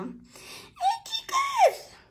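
very high squealing hello with a laugh; shrieking greeting. Real, not acted. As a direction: squeal it out to the viewers. Excited shriek greeting to viewers.

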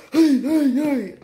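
high, loud hollering hey; loud goofy yell. Retold or imitated. Bagger running up yelling at customers, high loud holler. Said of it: "He's going".

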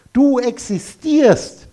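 Du existierst, slow, with the last syllable Tier hit loud and high; stress on Tier in existierst. Shown as a demonstration. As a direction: lean hard on the Tier in existierst. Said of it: "Betonung liegt auf Tier".

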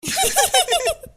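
high, loud burst of mocking laughter; a sharp, high cackle of teasing laughter. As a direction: laugh out loud at her excuse. Teasing laugh at cheap gift.

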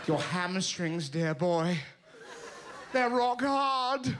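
slow, plummy masseur voice; posh masseur scolding a client about his tight legs. Retold or imitated. Slow mock posh tut. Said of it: "honestly went".

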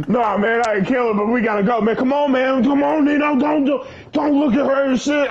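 high, pressing mock yell; dad urging his son to leave, mock yelling; retold urgent yell. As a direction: hurry them out the door. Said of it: "he's yelling at me".

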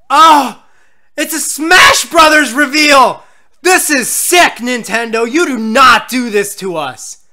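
loud, high, drawn-out protest; outraged shouting. Real, not acted. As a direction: yell it in outraged disbelief. Outraged at the Smash reveal.

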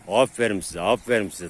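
ordinary talk

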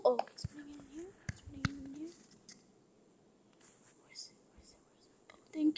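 soft unvoiced whisper. Real, not acted. Gamer whispering to himself while looting, soft whisper. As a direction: hush it to a whisper.

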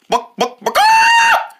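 clucks ending in a sudden high squawk; chicken sound. Acted. Practicing animal sounds, a chicken clucking. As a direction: cluck then squawk like a hen. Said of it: "The chicken goes".

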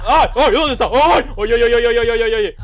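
high, loud panicked ay ay ay; startled gamer screaming in terror; real frightened screaming. Real, not acted. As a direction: shriek in genuine fright at the jump scare. Jump scare during horror game.

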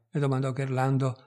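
plain reading voice

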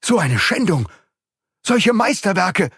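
high, outraged cry at the ruined art; the count sees his treasure shattered, cries out high; shocked outcry. Acted. Aghast, high-pitched outburst.

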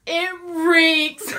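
disgusted yelling. Real, not acted. Smelly jelly bean up close.

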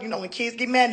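usual speaking voice

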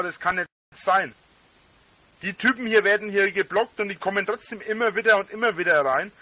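normal talking voice

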